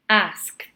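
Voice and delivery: "asked" ending in T; one syllable, "asked" said as "askt"; final T sound. Shown as a demonstration. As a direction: finish "asked" on T, no E vowel. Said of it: "we pronounce it with a T".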